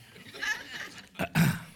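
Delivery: airy high chuckle at own joke; high breathy laugh. Real, not acted. Pastor laughing at his own quip, airy chuckle. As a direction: let a light laugh slip out.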